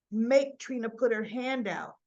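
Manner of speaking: usual speaking voice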